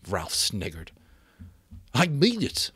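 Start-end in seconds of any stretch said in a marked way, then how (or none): none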